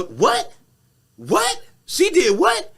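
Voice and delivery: high, loud incredulous "What?"; outraged disbelief. Retold or imitated. Meg hearing the insult, high loud disbelieving "What?".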